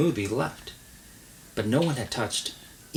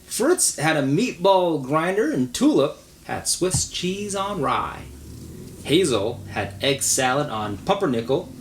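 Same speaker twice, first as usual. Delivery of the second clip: storybook narration, lively and even; reading to a child; 5 semitones higher than usual. A narrator's reading. Parent reading to child, storybook narration. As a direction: read it like a bedtime picture book. Parent reads picture book aloud.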